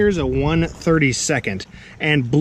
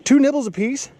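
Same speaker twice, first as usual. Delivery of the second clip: surprised; 10 semitones higher, 3 dB louder than usual. Real, not acted. Disbelief at the answer.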